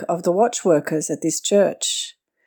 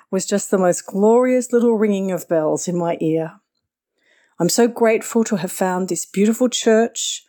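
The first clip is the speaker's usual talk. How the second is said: ordinary delivery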